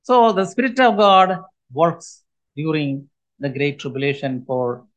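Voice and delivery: ordinary talk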